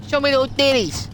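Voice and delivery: high, loud mock-American call; hammed-up American shout. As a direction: holler it with an American accent. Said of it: "Americans pronounce their T's like D's".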